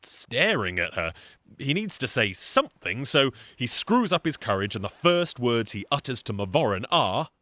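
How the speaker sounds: usual speaking voice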